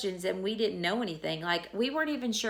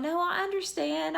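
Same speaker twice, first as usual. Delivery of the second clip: high-pitched, put-on understanding; trying to be positive; 10 semitones higher than usual. Patient on the phone with her doctor, trying to sound positive. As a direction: pitch it well up, bravely agreeable. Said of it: "trying to be positive or whatever".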